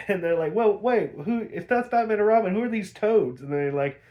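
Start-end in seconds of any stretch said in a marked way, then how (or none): 0.5-3.4 higher, louder puzzled questions; mock bafflement, pushing the question; confused exclaiming. Heroes wonder who the toads are, higher and puzzled.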